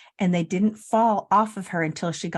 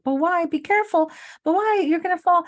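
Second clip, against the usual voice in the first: put-on high, pleading child's voice; worried little daughter; 9 semitones higher than usual. Little girl warning grandma not to fall, high and pleading. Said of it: "she was like".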